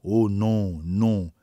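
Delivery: restrained, drawn-out cry of protest; a mild narrated cry of grief; cri de désolation. A narrator's reading. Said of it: "s'écria le conseiller Stanton". (s'écrier avec une douleur contenue)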